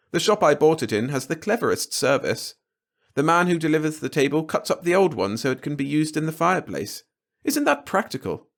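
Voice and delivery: high, bright delighted wife; delighted wife showing off her new things; gushing Marge. Acted. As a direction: gush over every new purchase, voice pitched high. Marge gushing over purchases.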